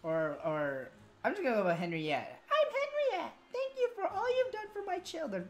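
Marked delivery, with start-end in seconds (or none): 3.5-5.5 high falsetto queen. Acted. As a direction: speak as a gracious queen. Voicing queen in cutscene.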